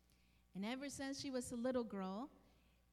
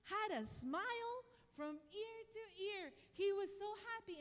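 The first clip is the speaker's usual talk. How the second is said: happy retelling; 8 semitones higher than usual. Retold or imitated. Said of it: "And she said".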